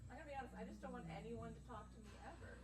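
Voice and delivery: normal talking voice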